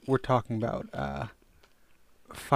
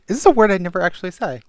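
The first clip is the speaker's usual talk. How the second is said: exasperated; 7 semitones higher, 11 dB louder, faster than usual. Real, not acted. Flustered over mispronouncing.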